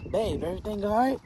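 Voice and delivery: put-on new boyfriend waking from the kitchen, soft and drowsy; sleepy. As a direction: ask it half asleep. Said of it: "he's kinda sleepy".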